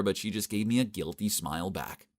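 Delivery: ordinary delivery, nothing marked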